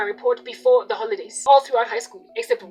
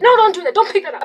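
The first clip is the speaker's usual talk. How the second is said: higher, louder scolding shout; yelling parent telling a child no; 7 semitones higher, 8 dB louder, faster than usual. Retold or imitated. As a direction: snap at the kid to stop. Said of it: "All they do is yell".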